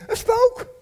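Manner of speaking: sudden high, loud cry of fright; alarmed outcry. Acted. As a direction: shout it as if seeing a ghost. Disciples' alarmed cry at seeing Jesus. (geschrokken, hoge uitroep)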